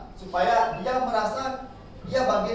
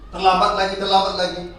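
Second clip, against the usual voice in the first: louder, repeated scolding of a late pupil; scolding high voice; 6 dB louder than usual. Retold or imitated. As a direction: nag the late pupil sharply. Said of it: "suara yang tinggi". (guru menghardik dengan suara tinggi)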